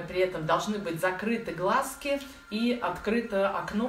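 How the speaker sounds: ordinary talk, nothing marked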